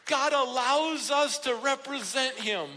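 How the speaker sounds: ringing, emphatic sermon delivery; impassioned preaching. Real, not acted. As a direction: press each point home with conviction. Preacher builds with conviction.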